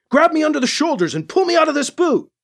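high-pitched, fast urgent yell; acted yell. A narrator's reading. Hero to cat man, urgent acted yell. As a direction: yell hurried instructions. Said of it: "he yelled to Kat".

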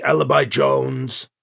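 slow, every syllable of "Alibi Jones" spelled out; over-enunciated translator. A narrator's reading. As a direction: stiff machine translator, slowly. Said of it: "the old speaker over-enunciated".

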